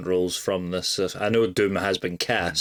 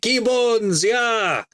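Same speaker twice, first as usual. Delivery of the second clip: very high, slow, hammed-up villain voice; squeaky, slow mock tyrant addressing his rival; Doctor Doom voice; 14 semitones higher, 5 dB louder, slower than usual. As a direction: play a cartoonish supervillain making grand demands, pitched way up and drawn out. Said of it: "that was my Doctor Doom".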